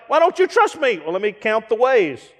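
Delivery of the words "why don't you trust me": higher whiny hurt plea; wounded, pouty demand; mock offended. Friend demands trust, mock offended and higher.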